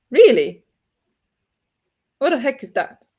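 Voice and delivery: ordinary talk, nothing marked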